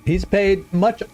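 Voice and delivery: ordinary talk, nothing marked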